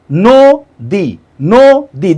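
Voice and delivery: নদী broken into "no" and "dee"; slow syllable split. Shown as a demonstration. Teacher counts syllables for students, নদী slowly in two beats. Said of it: "উচ্চারণ করি ধীরে ধীরে".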